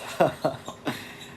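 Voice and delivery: breathy evil cackle; villain laugh. Acted. Villain Kerbax laughing before his introduction.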